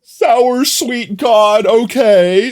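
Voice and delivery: drawn-out cry at something very sour; pained surprise. Real, not acted. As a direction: stretch it out, face screwed up from the sour taste. Reacting to a sour treat.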